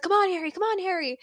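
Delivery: high, urgent cheering-on; excited cheering. Acted. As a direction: play a fan urging on the player. Voices Hermione cheering at game.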